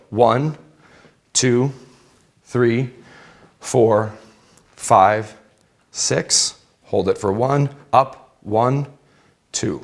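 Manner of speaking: slow steady coach count; counting workout reps. Shown as a demonstration. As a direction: call out each rep evenly. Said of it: "I'm gonna count it out for you".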